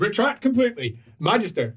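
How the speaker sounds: high, quick correction; emphatic retraction. Acted. Retracting the earlier remark on the title, insistent. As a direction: withdraw it completely, with force.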